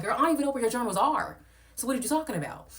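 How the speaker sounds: high, loud exasperated comeback; loud high retort to the host; heated retort. Retold or imitated. Own retort in heated argument.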